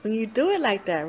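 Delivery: ordinary talk, nothing marked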